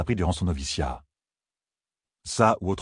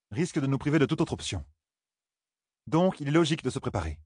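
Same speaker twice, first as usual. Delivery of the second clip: higher-pitched voice of a reasoning woman; logical, methodical woman; 4 semitones higher than usual. Acted. Woman explains to companions why they should prepare, methodical. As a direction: lay out the argument in a higher character voice.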